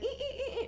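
very high, soft squeaking ee ee; guinea pig squeaks. Retold or imitated. Owner imitating her pet begging at the fridge, tiny high squeaks.

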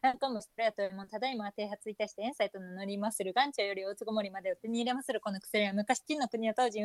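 stiff formal samurai recital. Acted. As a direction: recite the old merchant's pitch briskly. Tongue-twister recital of uirō-uri.

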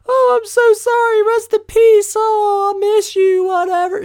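high, loud sing-song mock sympathy; exaggerated high mocking girly voice; mock grieving girl. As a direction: squeal it like a fake mourner.

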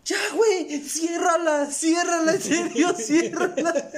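high, whining plea with a cry in it; whiny pleading. Retold or imitated. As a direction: beg them to stop, half laughing. Pleading with ASMR video eater.

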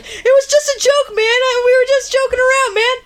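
high, whiny excuse; nervous pleading. Acted. Fuller pleading with Rusty Nail, high and nervous. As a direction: plead it's a joke, up high.

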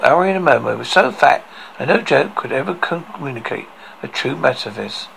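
ordinary delivery, nothing marked

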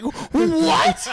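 high, loud disbelieving what; stunned, can't believe it; shocked what. Retold or imitated. Retold shock at dean laughing.

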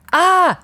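short high "Ah!" of fright; retold scream. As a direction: yelp as the deer appears. Sister hits a deer, scream.

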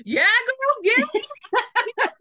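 high, loud cheering squeal; mock cheer. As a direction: cheer her on like a hype friend. Cheering the heroine on.

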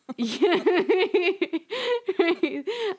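high bursting laugh; a real, high laugh that takes over; big laugh. Real, not acted. Laughing about her own problems.

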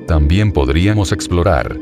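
ordinary delivery, nothing marked

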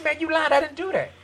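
replaying an indignant denial; retold upset protest. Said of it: "And I got upset".

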